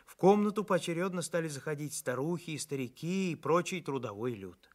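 ordinary delivery

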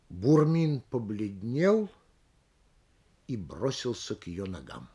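«побледнел» медленно, подвешено в воздухе, после долгая пауза, «к её ногам» тихо и низко; оборви на «побледнел», выдержи паузу и опусти голос на «к её ногам»; паузы многоточия. Shown as a demonstration. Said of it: "Многоточию должна соответствовать пауза". (тянуть «побледнел», пауза, затем тихое затухание на «ногам»)

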